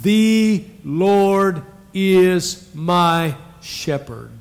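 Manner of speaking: very slow, word by word with the church; slow unison recitation. Shown as a demonstration. Pastor reciting along with the congregation, very slow. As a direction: say it together, slowly. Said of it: "Say that with me".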